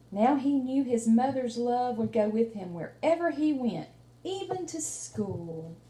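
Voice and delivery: lively read-aloud storytelling; reading to children. Acted. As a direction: tell the story to a small child, warmly paced. Teacher reads picture book aloud.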